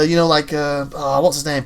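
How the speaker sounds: ordinary talk, nothing marked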